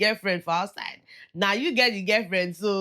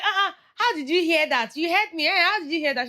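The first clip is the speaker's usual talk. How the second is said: retold shock; 8 semitones higher, 4 dB louder than usual. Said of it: "And he was like".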